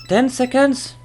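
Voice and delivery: ten seconds? shot up high in disbelief; sudden high disbelieving question; surprised question. Real, not acted. Reviewer doubting the skill timer, surprised high question.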